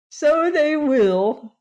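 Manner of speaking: high, loud, slow gleeful voice; gleeful chuckle. A narrator's reading. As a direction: savor the farmers' surprise with delight. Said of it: "chuckling gleefully".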